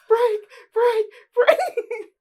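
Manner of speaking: high loud panicked cries; hammed-up panic yell. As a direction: scream for the brakes to hold. Panicking on sliding car.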